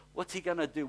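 usual speaking voice

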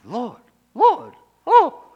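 very high, loud scandalised cry; mock shock. [name] to the Lord after being told to shut up, exaggerated shock. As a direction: squeal it in hammed-up horror.

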